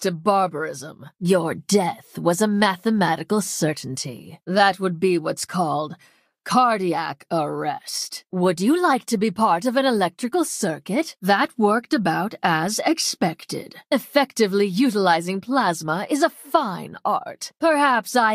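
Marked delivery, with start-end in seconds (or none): none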